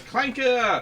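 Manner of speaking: shouted New Zealand Clanker; New Zealand accent. Retold or imitated. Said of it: "a large New Zealand accent going".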